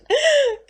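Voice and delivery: high snort of a laugh; burst of laughter. Real, not acted. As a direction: laugh out loud at the absurdity. Laughing at the statue clothes.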